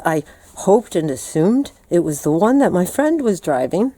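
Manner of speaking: ordinary delivery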